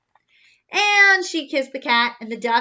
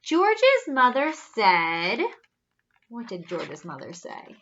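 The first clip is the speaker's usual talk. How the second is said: plain reading voice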